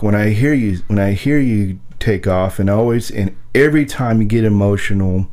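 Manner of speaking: ordinary talk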